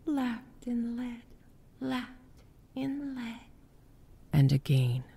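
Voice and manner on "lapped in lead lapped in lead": high, very soft, slow whisper; faint ghostly murmur. A narrator's reading. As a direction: murmur it like a gentle wave. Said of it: "her voice murmuring".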